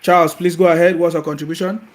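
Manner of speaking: loud call to a faint caller; to someone hard of hearing. Real, not acted. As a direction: project it over a weak line. Host calling to faint caller.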